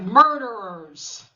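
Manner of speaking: loud, drawn-out retold accusation; mock accusing shout. Retelling the hero confronting his mother's captors, loud accusing cry. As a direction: call it out loud and slow.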